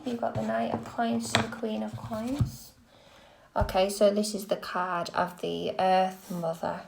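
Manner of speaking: ordinary talk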